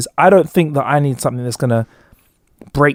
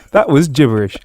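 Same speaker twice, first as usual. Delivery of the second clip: ordinary delivery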